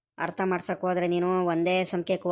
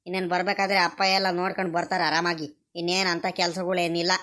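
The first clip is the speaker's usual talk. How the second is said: ordinary delivery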